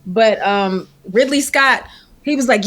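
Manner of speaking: ordinary delivery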